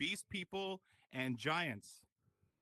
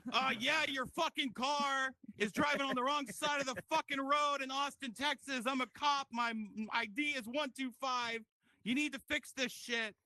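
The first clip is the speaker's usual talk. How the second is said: much higher, louder cop venting at the helpline; angry cop; 9 semitones higher, 4 dB louder than usual. Pretend. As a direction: snap at the operator, fed up. Cop angry at support line.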